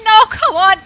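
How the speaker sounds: loud protest. Real, not acted. Loud protest during game.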